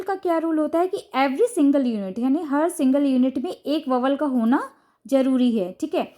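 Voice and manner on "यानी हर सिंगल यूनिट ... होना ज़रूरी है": ordinary talk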